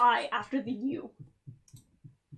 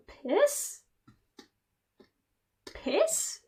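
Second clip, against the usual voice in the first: puzzled; 9 semitones higher than usual. Real, not acted. Puzzled by slang word.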